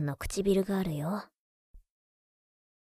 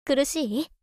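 higher, playful needling; teasing question; 6 semitones higher, 3 dB louder, slower than usual. Acted. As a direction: ask again with teasing delight. Teasing sister while pinching her nose. (からかうように高い声で)

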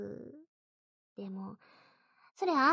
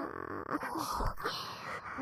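whispered giggle; 4 dB quieter than usual. Acted. Whispered laugh.

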